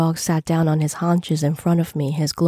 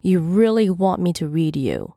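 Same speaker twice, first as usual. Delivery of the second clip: plain reading voice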